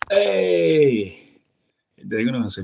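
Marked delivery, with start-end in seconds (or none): 0.1-1.4 loud, high, breezy "Hey"; casual Dominican greeting. Shown as a demonstration. Greeting a new friend the Dominican way, loud and casual. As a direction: call out "Hey" like a Dominican buddy. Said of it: "I say".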